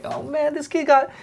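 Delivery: high mocking coach voice; hyped trash-talking coach impression. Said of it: "my coach is talking shit".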